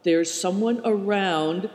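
plain reading voice

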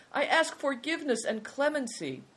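high-pitched appeal; high, entreating voice; crying out. A narrator's reading. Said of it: "cried the man".

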